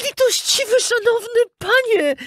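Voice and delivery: very high, begging little voice; pleading puppet boy. A narrator's reading. Puppet begging the director, high pleading voice. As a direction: beg for mercy in a squeaky child voice. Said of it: "prosił Pinokio".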